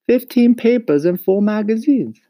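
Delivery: put-on high, loud boyish character voice; eager boy Mario. A narrator's reading. Mario reports his sales to Papa, high and eager.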